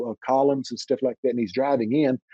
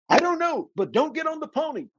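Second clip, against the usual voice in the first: put-on high, frightened child voice; a child too spooked to explain; scared little boy. Little boy under the covers answering his brother, high and scared. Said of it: "I said".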